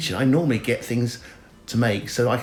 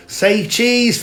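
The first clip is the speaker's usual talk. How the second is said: mock photographer, much higher; photographer's cue; 11 semitones higher, 7 dB louder than usual. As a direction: chirp the cue like someone behind a camera. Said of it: "'Cause what they do is they go".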